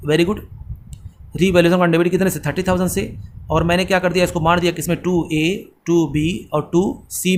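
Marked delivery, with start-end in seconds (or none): 5.0-7.4 slow dictation. Shown as a demonstration. Teacher dictates the entry names.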